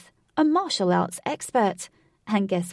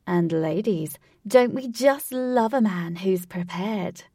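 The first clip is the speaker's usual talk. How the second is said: loud, slow, theatrical showman; eccentric showman voice; 3 semitones higher, 5 dB louder than usual. Acted. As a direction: ham it up like a game show host. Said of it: "in his eccentric voice".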